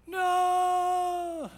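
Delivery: long, high, loud mock toddler wail; imitated child's wail. Three-year-old told to apologize to his sister, acted-out wailing refusal. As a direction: stretch it out as a bawling three-year-old would. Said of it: "And he goes".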